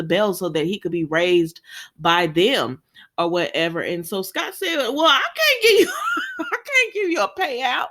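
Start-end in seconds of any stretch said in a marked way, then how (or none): 4.9-7.9 high, squeaky laugh-through retelling; laughing mock refusal. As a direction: crack up while quoting him.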